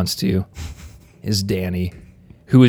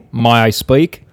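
louder mock-Australian request; Australian accent; 5 dB louder than usual. Host joining the guest's accent joke, mock Australian. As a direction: ask it with broad Aussie vowels. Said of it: "Sounds like Australian".

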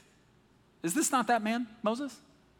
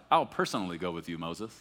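tender God; 7 semitones lower than usual. Retold or imitated. God answering anxious Moses, low and tender. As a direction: lean in gently, let Moses rest on it. God's tender reply voiced.

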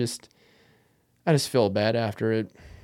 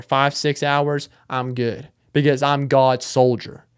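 ordinary talk

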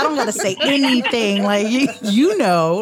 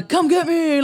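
higher, goading call-out; mock taunt; 7 semitones higher than usual. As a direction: dare them to come at you. Mimics a ghost hunter's taunt.

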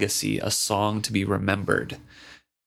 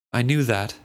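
ordinary delivery

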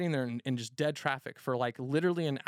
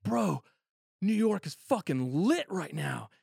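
higher, louder, slow amazement; higher, louder awed report to bartender; excited; 6 semitones higher, 3 dB louder, slower than usual. Retold or imitated. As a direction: tell the bartender like it's wild news. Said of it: "I'm like".

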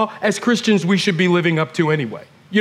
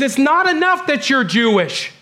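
building preacher emphasis; 8 semitones higher than usual. Real, not acted.

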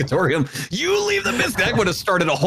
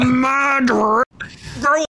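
Yosemite Sam voice; 7 semitones higher than usual. Retold or imitated. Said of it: "this is Yosemite Sam as Daenerys Targaryen".

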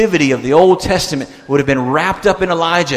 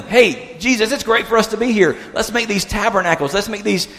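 high, chirpy blurting; eager Peter; 6 semitones higher than usual. Retold or imitated. Peter had to say something to Jesus, eagerly. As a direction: toss out the idea in an excited higher pitch.

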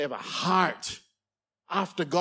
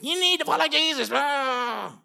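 angry preacher; 8 semitones higher than usual. Retold or imitated. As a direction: bark it like a sour zealot. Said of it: "Just angry, like lemon evangelists".

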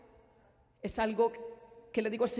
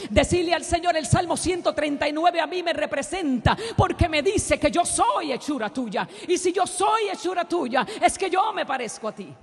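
full-force preaching; 6 semitones higher, 10 dB louder than usual. Acted. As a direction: proclaim it to the whole church. (predicación a todo pulmón, gritando)

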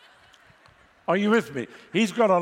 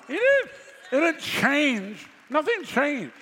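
emphatic preaching; 9 semitones higher, slower than usual. Real, not acted. Preacher insisting to the congregation, high and emphatic. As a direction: drive it home that nothing changed.

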